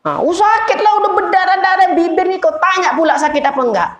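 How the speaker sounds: aggrieved victim squealing in pain; pained protest. Retold or imitated. Hurt man protests.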